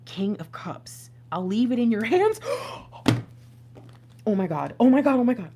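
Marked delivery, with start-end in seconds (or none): 1.3-2.4 startled exclaim. Real, not acted. Tarot reader startled by message. 2.4-4.2 sharp shocked gasp. Real, not acted. Reacting to uncanny song match. 4.2-5.5 loud, stunned repeated gasping oh my God; real shock at the cards, rising each time. Real, not acted. Tarot reader stunned by the match, loud shocked exclaim.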